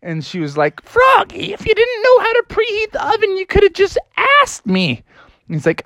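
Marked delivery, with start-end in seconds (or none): 0.8-5.0 very high mom voice; hammed-up exasperated mom; scolding mother. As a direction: chide Froggy in a high falsetto.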